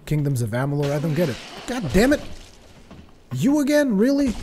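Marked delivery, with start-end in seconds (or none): 1.7-4.3 exasperated outburst. Real, not acted. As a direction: explode at the pest. Enemy interrupts again.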